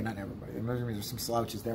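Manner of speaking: ordinary delivery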